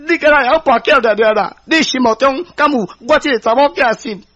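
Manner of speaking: high-pitched, out-of-control yelling; loud outburst. A narrator's reading. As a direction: lash out at your mother, voice pitched right up. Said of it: "伊原来大声讲". (失去理智大聲嚷)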